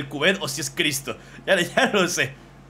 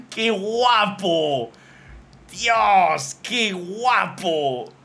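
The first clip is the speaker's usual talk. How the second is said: drawn-out, high, loud delight; slow, loud, high gushing over art; excited raving; 6 semitones higher, 5 dB louder, much slower than usual. Real, not acted. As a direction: stretch every word out in awe. Raves about fan drawing.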